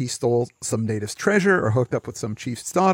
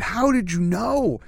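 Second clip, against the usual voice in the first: high, slow, horrified question; mock horrified; 7 semitones higher, slower than usual. Beardy guy found out by the narrator, mock horror. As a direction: act aghast, drawing the words out high. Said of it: "the beardy guy looks horrified".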